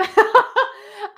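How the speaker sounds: bright burst of laughter; high real laugh. Real, not acted. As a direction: laugh out loud at yourself. Laughing at her jail cell.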